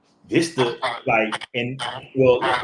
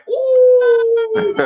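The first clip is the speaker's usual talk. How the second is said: loud, high-pitched drawn-out "oooh"; long sustained high whoop of an "ooh"; high excited "ooh"; 11 dB louder than usual. Retold or imitated. Actor reacting to his co-star's British accent, high loud ooh.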